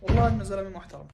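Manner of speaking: normal talking voice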